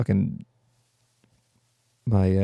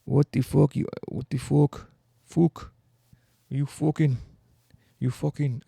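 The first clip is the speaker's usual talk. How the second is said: higher-pitched, botched Irish brogue; bad Irish accent; 5 semitones higher, slower than usual. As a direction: fumble for an Irish accent on each curse. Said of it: "I can't do an Irish accent".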